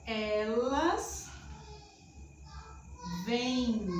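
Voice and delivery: "elas ... vêm" with long pauses; slow demonstration. Shown as a demonstration. Teacher slowly shows class the plural "vêm". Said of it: "Já no plural".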